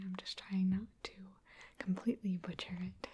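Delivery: ordinary talk, nothing marked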